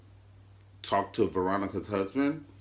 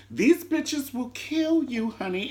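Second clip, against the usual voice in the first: much higher, slow put-on voice; mock crazy lady; 12 semitones higher, slower than usual. Host describing the old cast, mock crazy. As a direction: ham up a deranged old diva.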